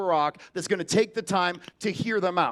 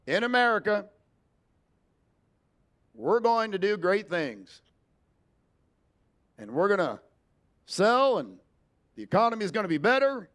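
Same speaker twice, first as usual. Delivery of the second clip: Barack Obama impression. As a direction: pause before each phrase like a statesman. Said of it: "Barack Obama has a conversation".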